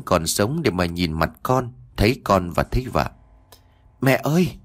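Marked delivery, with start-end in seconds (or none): 4.0-4.6 put-on high-pitched child calling for mother; child crying out. A narrator's reading. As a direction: cry out higher, without much volume. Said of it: "Nó liền gào lên kêu mẹ".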